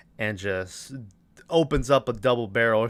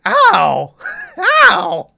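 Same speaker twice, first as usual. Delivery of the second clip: high, loud yelps of fake pain, half laughing; laughing ow ow cries; mock pained yelp; 10 dB louder than usual. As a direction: yelp like a whacked goof. Zombie hit with golf club.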